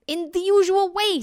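high, loud, impatient tiger; exasperated tiger. A narrator's reading. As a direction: answer as if it were obvious. Tiger exasperated by silly question.

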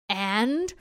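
ordinary delivery

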